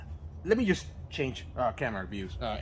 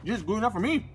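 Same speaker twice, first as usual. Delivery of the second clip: chewing steak; 7 semitones higher than usual. Real, not acted. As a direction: mouth full. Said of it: "talking with my mouth full".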